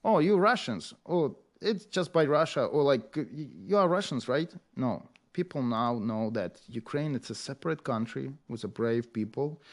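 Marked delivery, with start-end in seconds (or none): 0.0-4.5 mock foreigner. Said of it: "we don't want to be identified".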